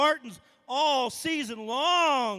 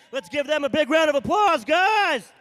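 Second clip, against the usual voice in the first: calling out; 2 semitones higher, 8 dB louder than usual. Real, not acted. Host calling for applause.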